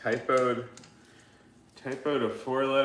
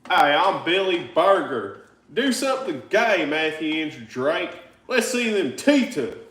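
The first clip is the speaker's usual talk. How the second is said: high, loud Southern holler as a viewer; caricatured Southern accent, pitched up; 5 semitones higher, 6 dB louder than usual. Acted. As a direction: put on a hammed-up Southern twang. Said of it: "maybe that's exactly how he sounds".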